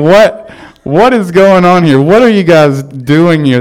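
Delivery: loud, high-pitched incredulous outburst; baffled dad. Retold or imitated. Dad reacting to his kids' strange project, loudly baffled. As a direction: raise your voice in amused disbelief.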